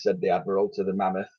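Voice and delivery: usual speaking voice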